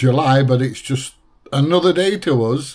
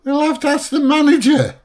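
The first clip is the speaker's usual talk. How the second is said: high-pitched mock cashier voice; 13 semitones higher than usual. Host mocking the cashier who fetches a manager, high mimicry. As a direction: put on a silly high voice to mock.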